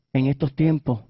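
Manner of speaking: soft and low, a quiet voice of God; God quietly lamenting his people, hushed and low; God speaking softly. Acted. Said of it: "Y Dios me decía".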